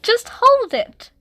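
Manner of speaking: put-on high little girl echo; toddler echo. A narrator's reading. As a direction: copy Mum in a bright small-child voice. Ruby agreeing.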